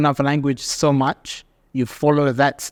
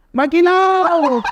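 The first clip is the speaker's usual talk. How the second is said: a much higher, louder drawn-out holler; called out name; 5 dB louder, much slower than usual. Retold or imitated. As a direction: shout the name from a passing motorbike. Motorbike rider calls out.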